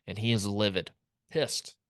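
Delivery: normal talking voice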